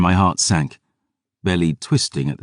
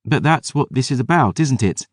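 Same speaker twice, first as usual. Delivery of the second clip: put-on gentle girl voice; 7 semitones higher than usual. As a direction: speak as a grieving young girl. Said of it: "she said".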